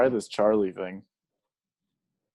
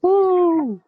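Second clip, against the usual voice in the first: high cheering whoop; 6 dB louder than usual. Real, not acted. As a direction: whoop loudly for the guest. Cheering guest's return.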